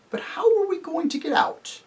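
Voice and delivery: very high character voice; anxious faun. Acted. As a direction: ask it in a high, worried pitch. Faun asks about locked gates.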